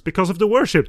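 high, loud mocking quote. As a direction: poke fun at the phrase. Mocking synopsis phrase.

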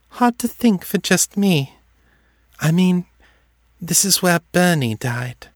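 lightly voiced lost young girl. A narrator's reading. Seffi voiced by narrator.

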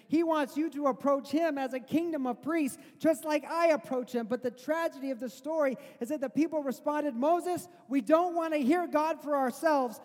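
loud, high, urgent sermon voice; preaching at full force. Acted. Preacher urging his congregation not to fear God, at full force. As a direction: preach it out, pleading with the crowd.

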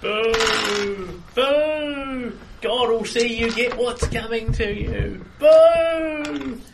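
high, jeering crone heckling the prisoner; old hag voice. Acted. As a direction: boo and curse like a creepy old hag. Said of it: "Old hag voice".

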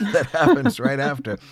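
laughing through the words. Real, not acted. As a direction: chuckle while saying it. Describing eerie pause, laughing.

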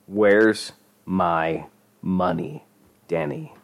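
word-by-word threatening demand; slow menace. A narrator's reading. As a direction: draw it out slowly, pausing before the name. Dealer leaning in, demanding money.

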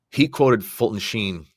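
usual speaking voice

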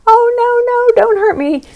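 squeaky high mock-pleading clown. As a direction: whine it like a tiny cartoon clown begging. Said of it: "a clown voice going".

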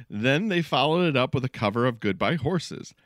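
ordinary talk

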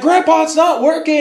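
put-on high, loud kid whine; whiny kid. Grandson complaining to grandpa about the ride, high whine. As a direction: whine like a little boy. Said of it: "he's like".

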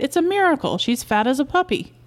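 high-pitched wonder; marvelling, voice swooping high; excited cry. A narrator's reading. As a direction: exclaim with delighted amazement. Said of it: "Albert cried".

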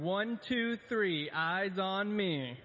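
sing-song attention call; kindergarten teacher voice. Shown as a demonstration. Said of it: "I'm probably the only staff member that can get, get away with this".

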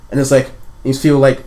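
normal talking voice

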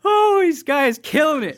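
loud, high, laughing delight; cracking up. Real, not acted. Host delighted by a listener's roasting review, cracking up. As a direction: howl it through laughter.

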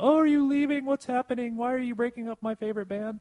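high, anxious fan voice; worried fan. Retold or imitated. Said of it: "very concerned about".

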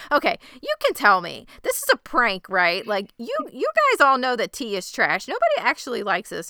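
high, loud, insistent disbelief; mock incredulity. Ted protests to Roy after sipping tea, exaggerated incredulity. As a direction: play it as exposing a prank, pitch jumping up.

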